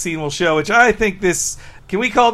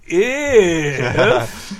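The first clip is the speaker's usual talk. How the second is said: "If" hugely drawn out; theatrical Homer. Retold or imitated. Showing how Homer presents those words, theatrical. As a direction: stretch the vowel of "If" like a stage pronouncement. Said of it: "theatrically presents those words".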